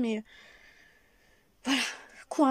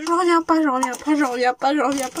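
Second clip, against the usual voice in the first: loud, giddy disbelief; excited disbelief; 8 dB louder than usual. Real, not acted. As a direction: shout it out in joyful shock.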